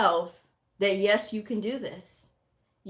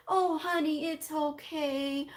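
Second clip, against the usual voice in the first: mocking someone who babies others; mock coddling; 6 semitones higher than usual. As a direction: coo at them like a coddling parent. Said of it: "They coddle people".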